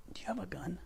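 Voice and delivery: breathy unvoiced whisper; hushed, conspiratorial whisper. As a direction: lean in and whisper it. Creepy whispered request in sketch.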